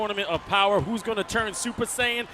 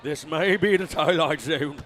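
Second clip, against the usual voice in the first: lightly put-on British accent, lower; a hint of a British commentator; 5 semitones lower than usual. Co-host joking about history in a lightly put-on British accent. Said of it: "speak in a British accent".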